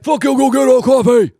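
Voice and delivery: loud, high put-on gruff voice; impression of Lewis. Mocking a friend's bossy tone, loud put-on voice. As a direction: bark it as a mock Lewis. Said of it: "will be like".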